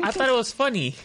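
high, loud protest; imitating a kid defending himself; mock pleading excuse. Acting out his excuse to teacher.

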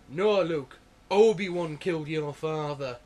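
loud, slow Yorkshire accent as a hammed-up Vader; David Prowse's Yorkshire accent. Retelling what Prowse said on set, in a loud Yorkshire accent. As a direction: bellow it in broad Yorkshire, slowly. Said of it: "in his Yorkshire accent".

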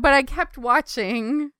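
ordinary talk

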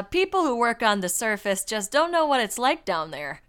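loud, put-on Canadian accent. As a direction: read it out in a hammy Canadian accent. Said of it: "do a Canadian accent for this".

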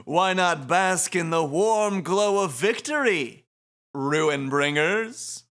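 slow, stretched-out grandstanding; showy host. Acted. Shiba presenting the winning team, showy. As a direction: draw every phrase out like an announcer.

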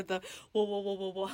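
quiet pulsing whoa whoa like a helicopter; soft dubstep-ish helicopter pulse. Shown as a demonstration. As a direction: murmur the whoas like rotor blades. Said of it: "I like mine better".

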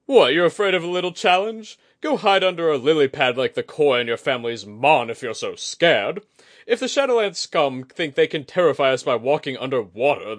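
mocking, loud warrior's scorn; scornful taunt. A narrator's reading. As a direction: sneer at his fear. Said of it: "gave a harsh laugh".

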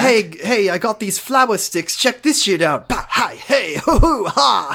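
high, show-off RA with little trick yelps; chummy RA. Retold or imitated. Cassidy demonstrating flower sticks to Mark, chummy RA. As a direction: show off like an overkeen dorm advisor. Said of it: "talks to Mark like he's his fucking R A".

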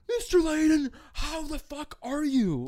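high, drawn-out mock-exuberant holler; overjoyed drunken greeting. Drunk guy bear-hugging friend's dad, high exuberant greeting.

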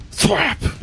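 high, sudden thwack noise; imitated head slam. Game master mimics David's head hitting the desk, sudden thwack. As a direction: pop out a sharp impact sound.

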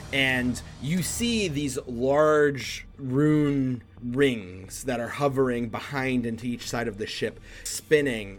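slow, drawn-out suspenseful narration; dramatic tabletop storytelling; game master scene setting. Acted. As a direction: stretch out the key words as the threat builds.

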